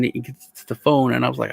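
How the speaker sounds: ordinary talk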